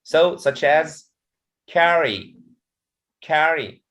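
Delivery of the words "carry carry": "carry" said as CAR-ry, falling after CAR; teacher's demonstration of "carry", first syllable stressed. Shown as a demonstration. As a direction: model CAR-ry, weight up front. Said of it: "the stress is the first".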